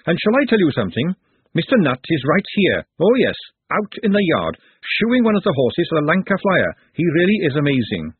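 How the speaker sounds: forthright cook, championing. Acted. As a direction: stand up for him proudly. Glenda champions Nutt.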